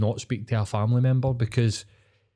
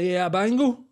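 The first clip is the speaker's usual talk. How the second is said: dad voice; 8 semitones higher than usual. Retold or imitated. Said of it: "my dad would say that".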